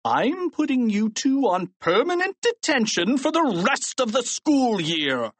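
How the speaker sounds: high, swooping furious sentence; angry shout. A narrator's reading. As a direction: pronounce punishment, worked up. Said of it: "Mister Krupp shouted".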